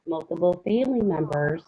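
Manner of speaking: usual speaking voice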